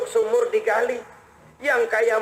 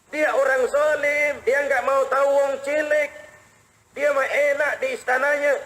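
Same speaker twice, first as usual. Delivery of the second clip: high, stretched-out indignant wail; scornful complaint; 6 semitones higher than usual. Retold or imitated. Poor mother denounces the caliph unknowingly to Umar, scornful complaint. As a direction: rail against him in mock outrage.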